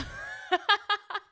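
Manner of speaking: sudden high-pitched laugh; high burst of laughter. Real, not acted. Laughing at the comment.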